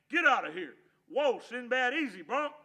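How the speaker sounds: loud, high yells from a bucking horse; yelling. Acted. Slim yelling at Hank while his colt bucks, loud yelling. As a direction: yell at the dog and calm the colt at once. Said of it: "he started yelling".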